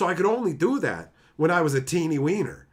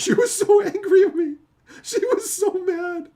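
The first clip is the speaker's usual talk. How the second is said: high-pitched laughing through the words; can barely get it out for laughing, voice cracking high; 5 dB louder than usual. Real, not acted. Laughing while retelling mom's anger.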